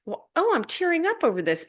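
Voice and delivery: amazed at her own tears; surprised at herself. Retold or imitated. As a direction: catch yourself mid-tears in surprise. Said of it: "I'll be like".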